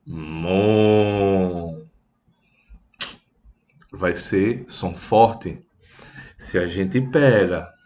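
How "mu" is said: low, drawn-out mock moo like an ox. Shown as a demonstration. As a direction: moo like a cow for the kids. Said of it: "o boi vai mugir lá".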